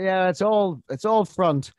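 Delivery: high, loud scoffing retort; mock scoff. His inner reply to the Welshman's jibe, a loud mock scoff. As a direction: dismiss it as all show, loudly. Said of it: "I thought".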